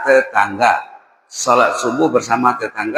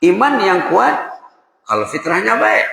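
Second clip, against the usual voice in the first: rising preaching; 7 semitones higher than usual. Acted. Preacher exhorts congregation.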